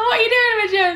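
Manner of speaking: giggling as she scolds herself; laughing self-scold. Real, not acted. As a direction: laugh while telling herself off. Laughing at her own mistake.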